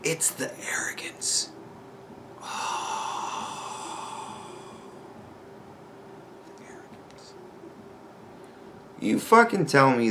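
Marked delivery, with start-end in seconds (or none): 0.0-7.3 exasperated whisper. Real, not acted. Vlogger fed up with arguers' arrogance, whispered exasperation. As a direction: whisper it, sigh heavily, whisper it fainter.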